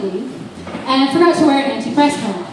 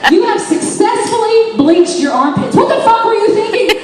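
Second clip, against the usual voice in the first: scolding friend; 10 semitones higher, 3 dB louder than usual. Retold or imitated. Friend scolding her about bleached armpits. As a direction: lay into her in disbelief. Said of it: "friend scolding".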